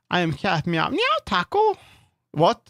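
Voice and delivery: a very high pretend meow to the cat; playful cat voice. Playing a cat to the pet Taco, squeaky high meow.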